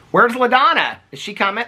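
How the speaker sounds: high, loud call for the bird; high, eager call to a bird. Real, not acted. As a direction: sing out her name up high. Calling out for the bird.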